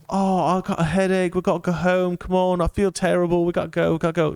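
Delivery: put-on whining English girl.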